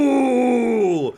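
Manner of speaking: high, loud monster cry; monster shout. Acted. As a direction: yell it as a silly monster. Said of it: "this dumb monster voice".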